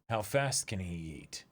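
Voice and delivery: ordinary talk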